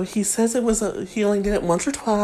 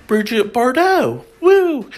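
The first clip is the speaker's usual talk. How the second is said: higher, louder cheer of a name; excited call-out; 5 semitones higher, 8 dB louder than usual. Retold or imitated. Reading [name]'s tale of calling out names, excited whoop. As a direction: whoop it out, pitch jumping up.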